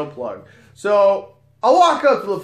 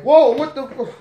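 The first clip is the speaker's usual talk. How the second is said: startled; 9 semitones higher, 6 dB louder than usual. Retold or imitated. Coworker startled by the yelling.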